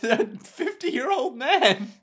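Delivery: very high, bewildered cartoon voice; squeaky character voice. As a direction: whine the question high, breaking into laughs. High Jacuzzi voice questions Niece.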